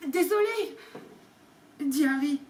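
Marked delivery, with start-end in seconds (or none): 0.1-0.8 higher, outraged; a shocked, offended cry; scandalized. Acted. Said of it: "Hagrid parut scandalisé". (indigné, voix plus aiguë)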